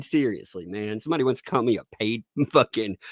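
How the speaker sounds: ordinary talk, nothing marked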